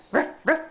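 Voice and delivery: playful dog bark. Pretend. Parent showing the baby what a dog says, mock bark. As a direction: bark it like a cartoon puppy. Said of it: "What does a dog say?".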